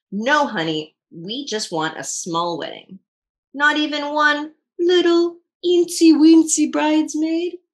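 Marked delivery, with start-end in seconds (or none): 3.5-7.6 pleading. Real, not acted. Child pleading with parent reading.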